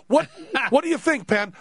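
high, insistent pressing; retold outburst, pitched right up; exasperated demand. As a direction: imitate a doctor losing patience. Exasperated doctor presses patient.

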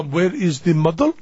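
questioning lilt in put-on Italian accent; mock Italian accent. Makeup man asking the room for the model, mock Italian accent. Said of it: "I'm gonna be doing an accent here".

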